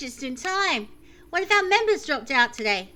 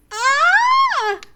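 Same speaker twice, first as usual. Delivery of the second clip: hesitant high scream, not full; a first, half-committed shriek; horrifying scream; 9 dB louder than usual. Acted. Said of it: "voice break into a horrifying scream".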